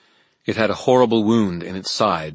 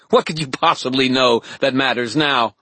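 scornful taunt; 6 semitones higher, 5 dB louder than usual. A narrator's reading. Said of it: "she laughed".

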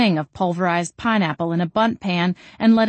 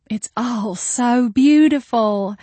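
slow, higher-pitched gushing sigh; 5 semitones higher, 4 dB louder, slower than usual. A narrator's reading. Guest of honor admires her baby shower, sighing. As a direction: stretch it out, overcome with admiration. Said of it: "Jolene sighed again".

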